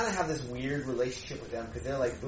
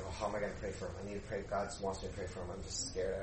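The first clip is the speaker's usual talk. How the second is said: anxious inner thoughts; 5 semitones lower, 9 dB quieter, faster than usual. Retold or imitated. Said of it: "I'm just like".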